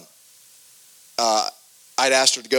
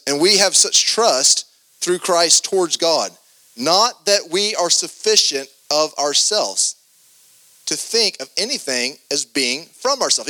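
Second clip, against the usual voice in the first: slow scripture reading; slower than usual. Acted. Reading scripture slowly, emphatic.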